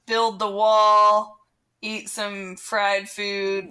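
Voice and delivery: slow, drawn-out mock billboard slogans. Mocking Texas church signs and billboards, slow and deliberate. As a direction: read each slogan out slowly, like a sign.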